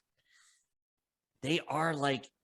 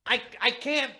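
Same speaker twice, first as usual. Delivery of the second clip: much higher, louder voice; 14 semitones higher, 11 dB louder than usual. Real, not acted. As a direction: squeak it out up high. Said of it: "You have to make your voice".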